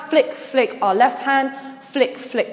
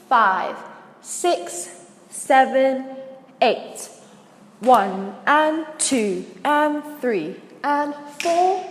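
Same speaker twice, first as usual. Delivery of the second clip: counting out beats; 3 dB quieter, much slower than usual. Shown as a demonstration. Dance teacher recapping from the beginning, slow measured counting. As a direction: stretch each beat and leave gaps. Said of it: "recap that with the counts".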